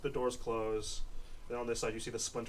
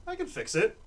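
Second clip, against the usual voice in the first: higher, louder quick retort; the woman; 12 semitones higher, 7 dB louder than usual. Acted. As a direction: snap back with a light defence. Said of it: "She goes".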